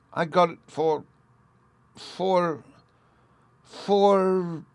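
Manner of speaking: stuck for an answer, stuttering; stammering. A narrator's reading. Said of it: "the detected conspirator stammered".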